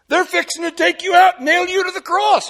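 high, loud worried objection; acted alarmed protest. Believer objecting to promised peace, in acted alarm. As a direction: blurt it out like a rattled doubter. Voices alarmed warning to Jesus.

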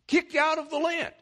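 emphatic preaching peak. Real, not acted.

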